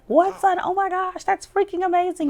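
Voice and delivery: very high, thrilled exclaiming; amazed excitement. Acted. Dad hearing son got into both schools, amazed excitement. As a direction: squeal in astonished delight as a proud dad. Dad reacts to son's good news.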